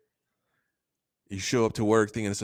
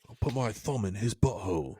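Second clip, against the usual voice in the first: softer, deadpan Australian impression; mock an Australian wildlife host's accent; 6 dB quieter than usual. Spoofing a crocodile man for co-hosts, Australian accent. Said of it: "what Crocodile Dundee you're watching".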